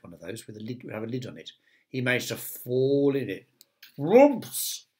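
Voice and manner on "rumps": a muffled cry from inside the basket. Acted. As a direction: call out as if shut in a basket. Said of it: "came a muffled voice".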